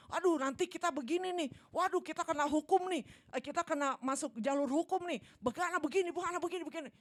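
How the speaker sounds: loud, high, frantic fretting; panicked worker. Acted. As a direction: fret loudly like a panicking employee. Panicky office worker acted out. (pegawai panik, suara tinggi dan keras)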